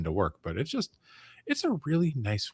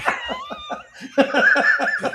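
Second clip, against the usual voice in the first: high laugh; 6 dB louder than usual. Real, not acted. Laughing during the impression.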